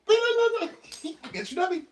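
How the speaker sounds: high, very slow silly voice. Real, not acted. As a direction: draw it out in a squeaky dog voice.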